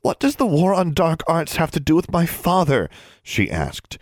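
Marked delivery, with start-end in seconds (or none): 0.0-2.9 put-on high, upset girlish question; struggling for control. A narrator's reading. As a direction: demand answers, fighting for composure. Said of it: "struggling to keep her voice".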